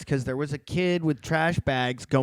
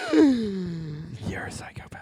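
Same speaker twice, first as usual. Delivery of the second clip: slow breathy whisper; whispered tease; 4 semitones higher, much slower than usual. Real, not acted. Friend teases him, slow whisper. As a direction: whisper it in mock horror. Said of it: "reacting to pit bull joke".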